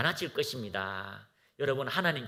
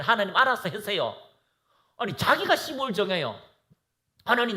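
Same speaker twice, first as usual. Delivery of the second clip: much higher and louder, mock-outraged; heated preaching; 6 semitones higher, 6 dB louder than usual. Preacher mocking deadline prayers to the church, loud and high. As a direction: preach it at full force.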